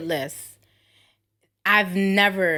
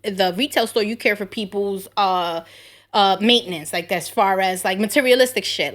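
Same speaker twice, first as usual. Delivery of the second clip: ordinary talk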